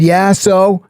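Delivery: retold high-pitched, challenging reply; defiant comeback. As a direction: answer back as if unimpressed. Retelling his defiant answer.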